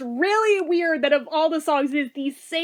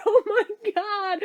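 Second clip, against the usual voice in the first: high, slow laugh-cry; laughing through tears; 6 semitones higher, slower than usual. Real, not acted. Remembering the news, laughing and tearful. Almost crying happy tears.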